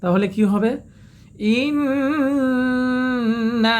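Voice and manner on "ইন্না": ইন্না with nasal nn held long and slow; long ghunna. Shown as a demonstration. Teacher models the stretched ইন্না for students, slow. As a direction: draw out ইন্না three beats. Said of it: "মুনফাসিল তিন আলিফ টান".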